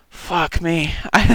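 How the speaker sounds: ordinary talk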